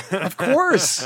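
high, loud realization; drawn-out happy realization; delighted recognition. Retold or imitated. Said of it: "I was like".

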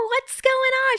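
very high, sing-song caring nurse; sweet nurse. Retold or imitated. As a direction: ask it like a gentle nurse. Nurse gently asks her.

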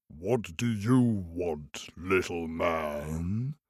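low, slow booming giant. Acted. Giant woken from sleep, slow deep rumble. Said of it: "giant voice".

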